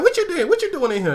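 high, suspicious mom voice; a mom bursting in, asking what's going on; nosy mom. Retold or imitated. Mom catching kid watching TV.

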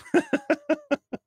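high-pitched burst of laughter. Real, not acted. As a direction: let a squeaky laugh escape. Host cracks up at own joke.

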